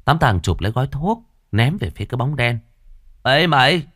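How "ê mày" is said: put-on loud, high drunken shout; drunken yelling. A narrator's reading. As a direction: bellow drunkenly at the shadow.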